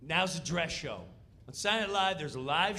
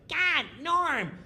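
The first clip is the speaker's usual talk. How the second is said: high, nagging needling voice; imitating Kattan's high taunting nag; mocking Kattan; 8 semitones higher, 4 dB louder than usual. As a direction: pick at Norm in a high whine. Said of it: "Kattan's going".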